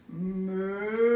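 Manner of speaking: low moo like a cow. Acted. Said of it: "George went".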